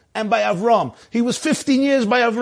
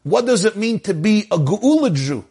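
ordinary talk, nothing marked

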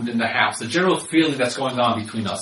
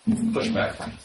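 usual speaking voice

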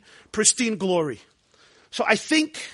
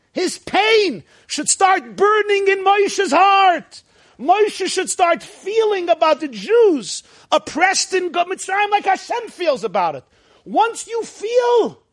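impassioned build; 9 semitones higher, 3 dB louder, slower than usual. Real, not acted. Preacher's rising passion.

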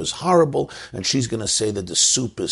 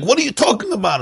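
high-pitched mock outrage; wife's high, indignant comeback; indignant retort; 14 semitones higher, 3 dB louder, faster than usual. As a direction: fire back in acted disbelief. Wife acts indignant in staged quarrel.

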